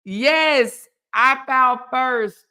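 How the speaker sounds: ordinary talk, nothing marked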